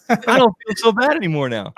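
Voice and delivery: high-pitched, laughing through the words; laughing relief. Real, not acted. Cohost no longer feels bad about their age, laughing.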